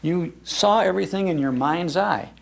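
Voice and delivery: ordinary delivery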